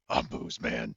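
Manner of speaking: low dramatic hero announcer; deep superhero voice. Acted. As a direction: growl it like a comic hero. Superhero-style alter ego line.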